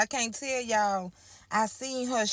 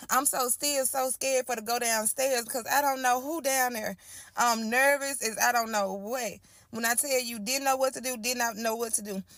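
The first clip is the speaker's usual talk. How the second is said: ordinary delivery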